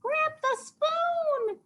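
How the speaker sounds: thin falsetto mock yell; yelling cat. A narrator's reading. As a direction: squeal the order like a frantic kitty. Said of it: "yelled Dakota".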